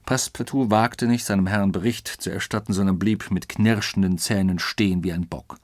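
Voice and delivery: ordinary delivery, nothing marked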